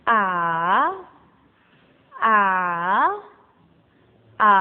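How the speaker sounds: "A" dipping low, then curving back up; dipping third tone. Shown as a demonstration. Teacher modelling the curved third tone, dipping low. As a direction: bend each "A" down and up again. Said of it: "Yang ketiga itu nadanya lengkung".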